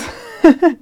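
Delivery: loud high laugh; high burst of laughter. Real, not acted. Laughs at own joke.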